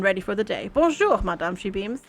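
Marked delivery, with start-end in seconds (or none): 0.7-1.5 put-on light French-accented maid voice. Maid Camille greeting her lady, light French accent. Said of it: "That French accent".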